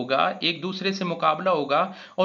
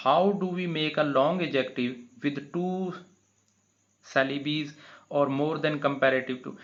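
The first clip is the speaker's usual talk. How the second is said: slow, deliberate read-aloud; teacher reading slowly; much slower than usual. Shown as a demonstration. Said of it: "reads the exercise question".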